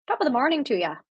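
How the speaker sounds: put-on Irish accent. Streamer testing what Irish people say, attempted Irish accent. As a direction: try out an Irish greeting in a lilting accent. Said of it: "did that sound a bit Irish?".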